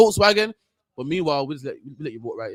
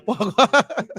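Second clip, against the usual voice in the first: "Wagwan" half laughed out; laughing; 3 semitones higher than usual. Real, not acted. Amused by his own patois impression, laughing. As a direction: laugh through the word "Wagwan". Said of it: "Love that one".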